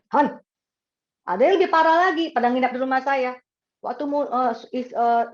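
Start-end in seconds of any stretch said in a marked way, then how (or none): 0.1-0.4 one abrupt "Hon"; no intonation left. Retold or imitated. Long-married spouse calling, curt with no lilt. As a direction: toss out the pet name without sweetness. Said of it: "nggak ada intonasi lagi".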